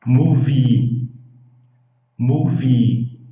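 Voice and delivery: movie, loud with a long final I; movie, stretch the ie; teacher modelling movie with a long I. Shown as a demonstration. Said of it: "pronuncia o I longo".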